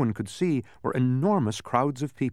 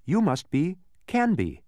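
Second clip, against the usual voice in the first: higher, slow, delighted deduction; bright conclusion; 7 semitones higher, 3 dB louder than usual. A narrator's reading. Milo naming the stranger, bright conclusion. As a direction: build brightly to the name. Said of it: "Milo concluded brightly".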